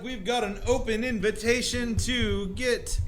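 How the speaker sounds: cocky character voice. Acted. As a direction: drawl out the invitation with a grin. Character remark after letter.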